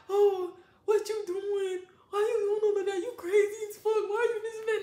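mock his complaint; whiny man. Man whining at her.

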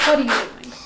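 ordinary delivery

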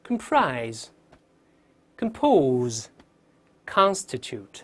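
"comprise", "compose", "constitute" read singly with pauses; weak "com" in "comprise", "compose", strong "CON" in "constitute"; clear model reading. Shown as a demonstration. Teacher rereading three words for students, clear model reading. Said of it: "把这三个我们再读一遍".

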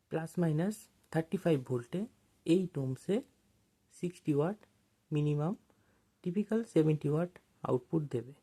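usual speaking voice

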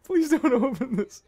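high, breathy, pleading fear; real panic. Real, not acted. Gamer hiding as someone nears the door, terrified pleading. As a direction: beg under your breath, terrified. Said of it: "I am actually, like, shaking".

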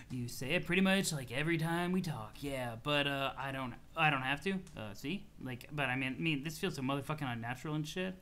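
low, soft, halting stoner voice; laid-back troll, unbothered. Acted. Easygoing troll answers his friend's complaint, laid-back and unbothered. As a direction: amble through it, low and unhurried in mood.

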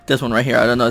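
usual speaking voice

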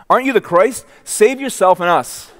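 high, loud, scornful taunt; hammed-up jeering criminal; mocking jeer. Criminal hurls insults at Jesus.

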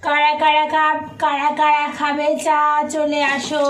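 loud, slow call to the household. Real, not acted. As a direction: call everyone to come. Calling out who wants tea.

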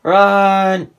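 loud urgent yell; cover me shout. Real, not acted. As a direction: bark the warning high. Urgent callout in match.

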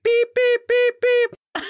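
high loud bleeps; censor beeps. Retold or imitated. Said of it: "the radio station would be like".

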